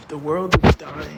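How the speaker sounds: loud, over-the-top despair; dramatic outburst. Acted. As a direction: proclaim doom, hammed up. Mock doom over sudden snow.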